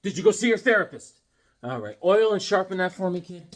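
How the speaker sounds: usual speaking voice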